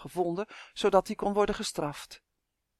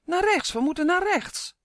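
high-pitched yelled command; high, insistent acted yell; urgent shout; 12 semitones higher, 4 dB louder than usual. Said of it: "schreeuwde Uf".

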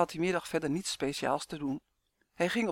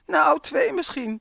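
high, loud, drawn-out taunt; teasing; 10 semitones higher, 9 dB louder, slower than usual. A narrator's reading. Boy provoking his mother after tickling, teasing. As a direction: tease slowly in a raised sing-out. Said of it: "plaagde Roy".

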